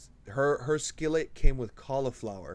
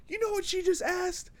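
much higher, mocking yell to the other server; mock scream. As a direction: call it out to the whole room. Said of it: "and he's screaming it".

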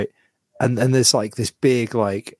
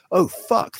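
sudden much higher retold gasp of shock; startled; 9 semitones higher than usual. Zoo visitor startled by a real lion, in a high retold shock. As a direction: jolt at the real lion's roar. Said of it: "And I was like".

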